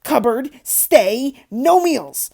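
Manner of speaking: loud, slow, choked with rage; spluttering fury. A narrator's reading. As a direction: force out each word, too angry to speak. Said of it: "He was so angry he could hardly speak".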